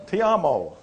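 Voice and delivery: ordinary talk, nothing marked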